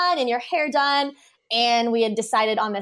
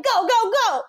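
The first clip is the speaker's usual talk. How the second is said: excited high shout; 12 semitones higher than usual. Retold or imitated. Friends rushing into the ocean at sunset, excited high calling out. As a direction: go go go, pitched way up and urgent.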